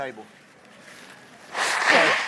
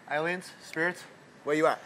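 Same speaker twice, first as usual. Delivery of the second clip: ordinary talk, nothing marked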